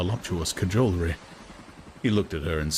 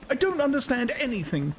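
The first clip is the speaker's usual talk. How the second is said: high, firm woman's voice; a woman refuses to understand; decided. A narrator's reading. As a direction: state it flatly with decision. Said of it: "she said with decision".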